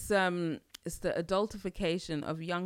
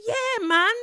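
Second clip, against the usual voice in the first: gleeful agreement; 13 semitones higher, 11 dB louder than usual. Retold or imitated. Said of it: "I was like".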